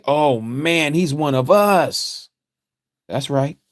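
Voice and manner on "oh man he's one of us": slow, slightly raised mock believer; mock gullible believer. Preacher mocking a believer fooled by the C word, slow and raised. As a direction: imitate a naive churchgoer being won over. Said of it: "you just say".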